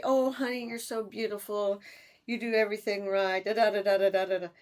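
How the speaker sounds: high, loud, syrupy mock flattery; mock sweet talk. Ex sweet-talking her the day after the insults, mocking. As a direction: lay the fake sweetness on thick.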